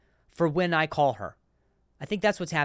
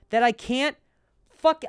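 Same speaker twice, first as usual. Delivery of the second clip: ordinary talk, nothing marked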